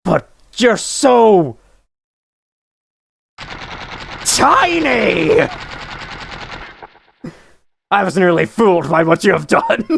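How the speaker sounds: grand theatrical royal voice; pompous king voice. Acted. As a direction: proclaim it like a vain king. Voicing the game's king character.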